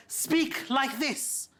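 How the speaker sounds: very slow, drawn-out colonial command; slow posh instruction. Retold or imitated. Colonial British teaching Indians, very slow. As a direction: slowly show them how to speak. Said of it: "told us".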